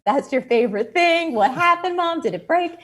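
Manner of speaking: high, loud worried questions; surprised worry over the broken radio; alarmed. Retold or imitated. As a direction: daughter alarmed at the dismantled radio. Mom dismantled favorite radio.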